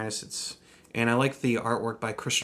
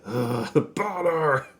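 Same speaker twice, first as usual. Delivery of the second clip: eye-rolling annoyance; 4 semitones higher, 4 dB louder, much slower than usual. Retold or imitated. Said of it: "he's like, he sorta rolls his eyes".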